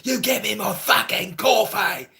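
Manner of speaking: high-pitched, irate English accent, imitated; angry London Englishman. English guy lambasting Filipino restaurant workers, angry London accent. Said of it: "this English guy from London or whatever was lambasting".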